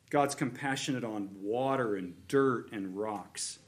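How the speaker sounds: ordinary delivery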